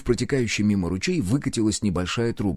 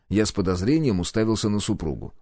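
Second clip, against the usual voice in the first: ordinary delivery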